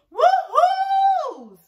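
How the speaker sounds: shouted high and loud, slowly drawn out; excited shout. Real, not acted. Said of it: "nice and loud".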